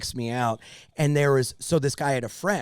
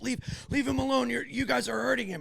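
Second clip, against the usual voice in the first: higher voice, stumbling protest; imitated pleading bystander; 9 semitones higher than usual. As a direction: imitate an upset onlooker begging them off.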